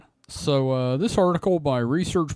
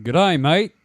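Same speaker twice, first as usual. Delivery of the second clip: a light, louder mock Australian G'day, mate; mock Australian accent; 6 dB louder than usual. Host greets the listeners in a mock Australian accent. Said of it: "I'm not gonna do that accent".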